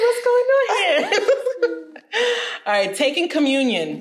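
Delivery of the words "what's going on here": high, laughing mock shock; laughing disbelief. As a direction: squeal it through laughter. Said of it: "I was like".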